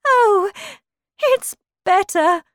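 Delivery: high, loud exclamation from the Queen; crying out. Acted. Queen answers Alice about her finger, crying out. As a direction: call it out high and bright-eyed. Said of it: "cried the Queen".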